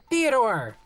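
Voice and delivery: high loud call upward. Acted. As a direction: call up to the rafters. Calling upward.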